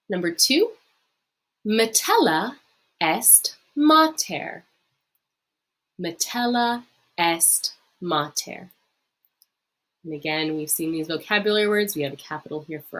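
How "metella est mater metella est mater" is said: slow, syllable by syllable, for the class to repeat; slow model reading. Shown as a demonstration. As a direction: read it out slowly so students can copy. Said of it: "practice saying it yourself".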